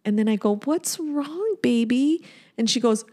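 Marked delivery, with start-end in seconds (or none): 0.7-2.2 soft, higher motherly voice; tender concern. Retold or imitated. Mother asking her crying daughter, tender concern. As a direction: soothe a child gently.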